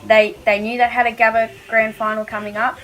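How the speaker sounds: ordinary talk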